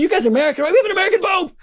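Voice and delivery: thrilled aside to fellow Americans; excited. Retold or imitated. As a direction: share the news gleefully with strangers. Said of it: "I said".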